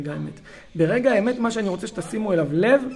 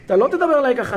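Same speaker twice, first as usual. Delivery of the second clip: raised, higher authoritative scolding; escalating parental yell; 5 semitones higher, 5 dB louder than usual. Retold or imitated. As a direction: pull rank like an angry parent. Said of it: "אני צועק יותר". (הורה סמכותי שמרים את הקול)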